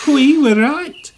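high, slow gloating; chuckling. A narrator's reading. Ben gloating over Derek's breakdown, chuckling high voice. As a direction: crow it in a high voice. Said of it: "chuckled Ben".

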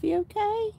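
high pet voice. Real, not acted. Owner asking her rabbit in high baby talk if it's okay. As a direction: ask the bunny tenderly.

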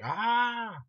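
high, loud mock scream; hammy movie-madman scream, imitated; imitated McConaughey scream. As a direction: let out a long, over-the-top "ah". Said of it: "and just screams like".

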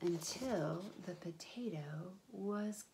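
soft slow whisper. Acted. Storyteller ends the feast, slow whisper. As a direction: let it fade to a whisper.

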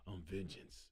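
very low, very soft growl; Batman voice. Retold or imitated. Quoting the new Batman line, very low and soft. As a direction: breathe it out like the dark knight. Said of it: "when he fight them dudes".

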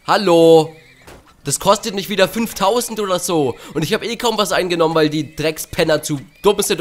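high, loud ranting fury; losing it completely at the game; berserk rage. Real, not acted. Gamer raging over losses. (rasende Wut)

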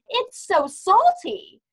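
high, loud, drawn-out whine of complaint; mock complaint. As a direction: imitate mom's appalled reaction, slowly stretched. Acting mother's complaint about water.

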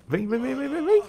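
sing-song high lure; high coaxing call. Real, not acted. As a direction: coax them in sing-song. Luring enemies for combo.

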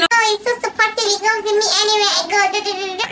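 high mock gushing; naive girlfriend. Woman defending her clingy man, high mock-gushing chatter. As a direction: parody a doting girlfriend in a high put-on voice. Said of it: "You might be thinking".

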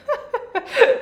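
high-pitched burst of laughter; real, high giggling laugh. Real, not acted. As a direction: burst out laughing at your own slip. Laughs at her own slip.

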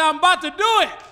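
high, loud shouted declaration; God's shouted promise. Acted. God promising Hezekiah, shouted and high. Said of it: "God said".

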